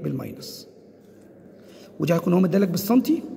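ordinary delivery

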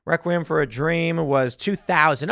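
usual speaking voice

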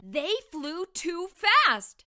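usual speaking voice

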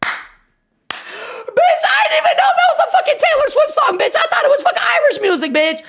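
fast, high-pitched squawk; shrieking protest. Real, not acted. Streamer corrected about a [name] song, high shrieked protest. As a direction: shriek your defence in a laughing rush.

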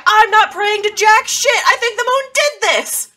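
high, loud, outraged yell; full-volume, high-pitched protest; screaming refusal. Acted. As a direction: shout it back in disbelief. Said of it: "kinda just screaming down the street".

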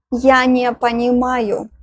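ordinary talk, nothing marked

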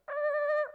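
high, loud mock screech of brakes; a sudden skid sound, high and loud; screeching brake noise. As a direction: squeal it like tires skidding on a freeway. Imitating brakes slamming on her life.

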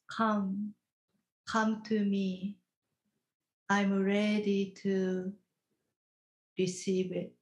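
ordinary talk

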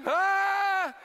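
loud, high mock scream; horrified cry. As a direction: cry out in comic horror. Acted horror at soaked van.

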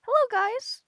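accidental high squeaky greeting. Acted. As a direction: greet them in a silly squeak. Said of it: "what am I doing with my voice".

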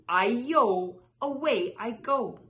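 loud, gleeful getaway cry. A narrator's reading. Runaway rice cake flees a market woman, crying out. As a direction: call it out big as you escape. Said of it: "It cried".

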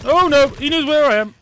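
loud, high panicked cry; alarmed outburst. Real, not acted. Player panicking as the enemy hunts him.

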